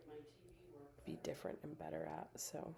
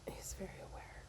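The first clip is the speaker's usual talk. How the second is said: whisper; 6 dB quieter than usual. Real, not acted. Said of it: "repeated in a whisper".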